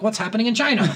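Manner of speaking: high-pitched, laughing mock panic; mock alarm. Imagined reaction to a fake attack, mock alarm. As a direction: play a baffled searcher for laughs.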